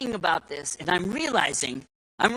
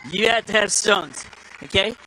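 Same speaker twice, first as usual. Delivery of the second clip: usual speaking voice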